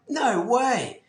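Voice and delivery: high, amazed no way; disbelief, pitched well up; retold amazement. Hears stranger owns the Ferrari.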